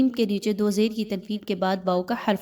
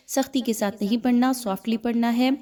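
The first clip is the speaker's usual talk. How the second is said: usual speaking voice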